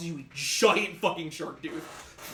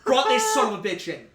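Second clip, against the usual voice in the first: put-on higher, louder rough sailor accent; loud proud declaration; 8 semitones higher, 10 dB louder than usual. Captain shouting his boast at the crew, loud sailor accent. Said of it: "he goes".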